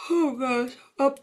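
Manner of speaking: words swallowed by a real yawn. Real, not acted. Host caught yawning mid-class, yawning. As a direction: talk through a big yawn. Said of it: "I'm really yawning".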